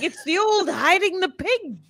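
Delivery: put-on high, bleating old lady voice; goat-lady impression. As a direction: do the lady who's been turned into a goat. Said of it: "That's my impression of, of that lady as a goat".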